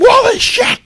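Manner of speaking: high, loud yell of amazement; shouting, blown away; loud disbelief. Retold or imitated. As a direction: re-enact an astonished outburst. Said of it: "so loud, 'cause I couldn't believe".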